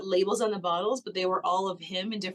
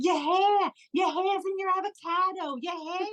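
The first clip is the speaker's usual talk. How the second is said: mock urgent shout about her hair; urgent alarmed call; 10 semitones higher than usual. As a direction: call out to a passed-out girl, high and alarmed. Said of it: "one of the guys at the table was like".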